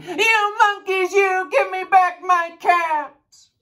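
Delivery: very high, loud held-out holler; furious shout. A narrator's reading. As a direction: shout in a storybook rage, stretching the last words. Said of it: "He stamped both his feet and shouted".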